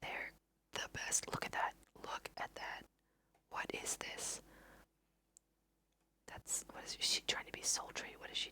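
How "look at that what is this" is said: ordinary delivery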